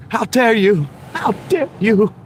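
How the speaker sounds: very high cracking Greta Thunberg voice; shrill, outraged high-pitched "how dare you"; impression failing as voice gives out. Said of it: "I can't do the Greta today".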